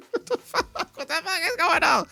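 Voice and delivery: high, breathless laugh through the words; cracking up in shock at the scene; laughing disbelief. Real, not acted. Recapping the episode, laughing in disbelief.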